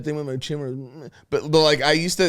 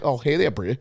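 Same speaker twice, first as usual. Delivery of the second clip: put-on New Zealand accent. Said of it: "a little bit of a different accents".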